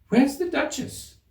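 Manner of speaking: high, slow question; curious Alice. A narrator's reading. Alice asks the rabbit, slow curious voice. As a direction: ask it innocently.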